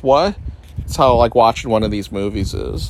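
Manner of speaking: ordinary talk, nothing marked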